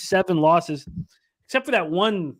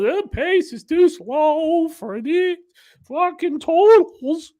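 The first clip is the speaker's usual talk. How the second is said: put-on high, slow old man voice; 11 semitones higher, 5 dB louder, much slower than usual. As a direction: put on an old man's voice, pitched up and dragged out. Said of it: "I sound like an old man".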